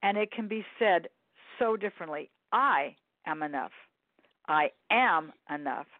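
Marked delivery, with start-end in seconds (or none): 2.5-3.7 I stressed with a falling tune; I said firmly, then am enough lightly. Shown as a demonstration. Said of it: "put the emphasis on all three of those words". 4.5-5.8 am stressed with a falling tune; I am enough with the weight on am. Shown as a demonstration. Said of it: "put the emphasis on all three of those words".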